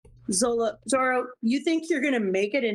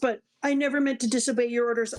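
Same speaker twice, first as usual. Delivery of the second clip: normal talking voice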